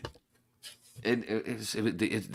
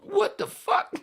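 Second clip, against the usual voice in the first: high shocked cry; 6 dB louder, slower than usual. Retold or imitated. Reliving the shock.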